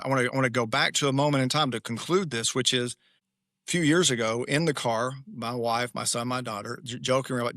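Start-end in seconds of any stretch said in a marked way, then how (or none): none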